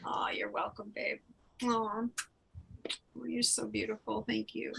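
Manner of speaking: soft tender whisper. Real, not acted. Medium whispering thanks back to a moved client. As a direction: breathe the words, barely voiced.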